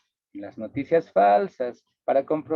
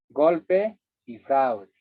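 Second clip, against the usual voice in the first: Golpe y fraude, spelled out very slowly; slow slogan; 4 dB louder, much slower than usual. Shown as a demonstration. Teacher quoting the daily political slogans, slow and heavy. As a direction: lay each word down heavily. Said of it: "Ese discurso oímos cotidianamente".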